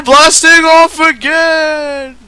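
high, loud blasting-off cry; cartoon villain yell. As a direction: shout it as you fly away. Team Rocket style catchphrase.

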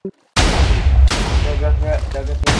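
slow loud call; loud warning. Real, not acted. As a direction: call it out slowly. Warning teammate in game.

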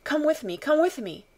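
high, urgent plea; urgent cry. A narrator's reading. Panicked mother calling the officer to follow, crying urgently. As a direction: cry out, urging them along in alarm. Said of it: "the Mem Sahib cried".

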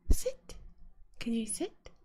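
usual speaking voice

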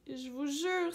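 ordinary delivery

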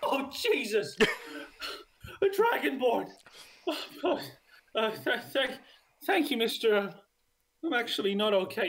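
a desperate little man begging a dragonborn for help; panicked father NPC. Acted. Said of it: "He looks up at you and goes".

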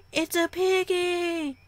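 high, drawn-out squeal of delight; gushing delight. Acted. Acted delight at discovering pig.

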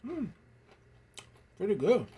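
talking between bites; chewing. Real, not acted. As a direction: mouth partly full of chips. Seen 0: Ate chip at -7; closed, working mouth through the words.

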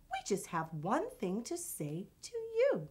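usual speaking voice

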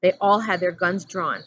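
plain reading voice

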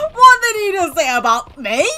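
high, loud outraged question; offended disbelief. Real, not acted. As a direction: demand it loudly, scandalised. Insulted by game character.

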